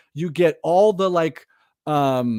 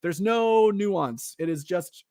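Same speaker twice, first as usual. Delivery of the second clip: ordinary talk, nothing marked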